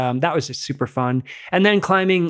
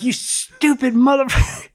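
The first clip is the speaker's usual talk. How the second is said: higher, slow, drawn-out exasperated insult; mock exasperation; 11 semitones higher, 3 dB louder than usual. Pranked partner scolding the friend who stayed silent, slow mock outrage.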